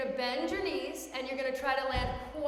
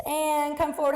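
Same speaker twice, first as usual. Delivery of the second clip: usual speaking voice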